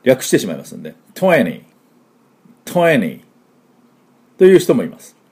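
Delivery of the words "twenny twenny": "twenty" as American "twenny"; American dropped T. Shown as a demonstration. Teacher showing how Americans shorten it, twenty without second T. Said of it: "アメリカ人はこのtwentyのTを".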